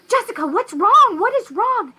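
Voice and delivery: high, urgent questioning; alarmed mother. Retold or imitated. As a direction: plead to know what's wrong over the screaming. Alarmed mother to screaming child.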